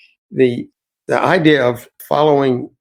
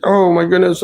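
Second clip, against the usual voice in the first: higher, louder mock delight as the retold husband; excited old man; 8 semitones higher, 4 dB louder than usual. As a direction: imitate the husband lighting up at the news. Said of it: "And then he got so excited".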